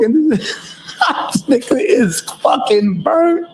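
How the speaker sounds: cracking up while saying it; laughing. Real, not acted. Cracking up at friend's antics.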